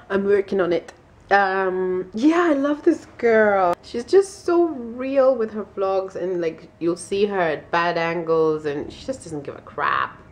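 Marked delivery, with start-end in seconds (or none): 2.1-6.1 slow, higher put-on Scottish voice; drawing it out in a Scottish lilt; mock Scottish accent. Praising Jamie in a slow, higher mock-Scottish accent. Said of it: "I don't think my Scottish accent is very good".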